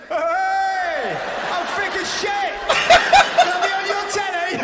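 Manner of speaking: high, loud mock idiot voice; mock thick TV guest. Comic mocking a thick reality show guest, loud and high. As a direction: shout it like a proud idiot on telly. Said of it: "They come on the telly".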